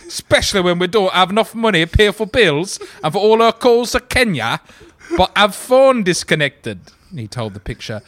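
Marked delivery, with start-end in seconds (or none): 0.1-6.8 put-on working class English accent. Reading the husband's magazine quote aloud in a mock working class English accent. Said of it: "working class English people, so here we go".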